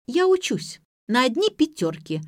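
put-on high-pitched, defensive young student; defensive retort. A narrator's reading. Granddaughter defends herself to grandmother, high and defensive.

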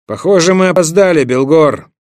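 loud, fast call. A narrator's reading. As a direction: hail him from afar. Olaf calls out arriving. (громкий оклик)

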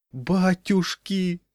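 high, drawn-out groan of dismay; moaning. A narrator's reading. Crowd watching the stuck bell, moaning in dismay. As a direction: wail it slowly, aghast. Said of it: "стонала толпа".

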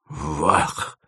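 hushed, stunned exclamation; awestruck. A narrator's reading. Dima is shown the material, awestruck. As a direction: breathe it out in shock. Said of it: "сказал Дима потрясённо".

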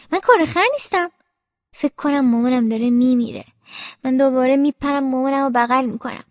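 ordinary delivery

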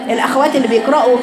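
ordinary talk, nothing marked

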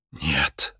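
low, soft exhaled word; breathed out. Acted. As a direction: let it out on a breath, low and quiet. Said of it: "выдохнул Джо". (тихо, на выдохе)

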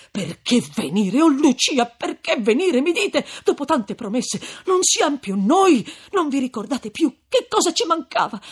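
loud, heated, climbing outburst; indignant reproach. A narrator's reading. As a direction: throw the question back, hurt. Renzo indignantly echoes her question.

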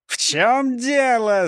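drawn-out, high-pitched roar; outraged shout with stretched words; indignant yell. A narrator's reading. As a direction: bellow it slowly in outrage. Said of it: "заорал он и оглянулся".

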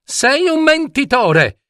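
high-pitched outburst without much volume; indignant accusation. Acted. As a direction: prince flinging an acted accusation. Prince accuses the monster of lying. (accusa indignata, acuta)

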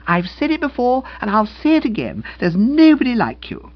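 high-pitched gushing feminine voice; admiring young woman. Acted. Young woman praising the valet, effusive. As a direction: pour out heartfelt praise brightly.

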